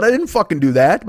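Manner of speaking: ordinary talk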